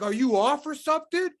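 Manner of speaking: high, loud mock demand; retold challenge. Sergeant challenging a soldier, high and incredulous. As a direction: imitate an incredulous superior, pitch climbing steeply.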